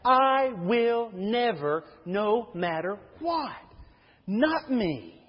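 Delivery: slow, drawn-out, deliberate insistence; mildly voiced Peter, slow and emphatic; emphatic vow. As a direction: lean hard on every word of the promise. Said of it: "the writing is emphatic".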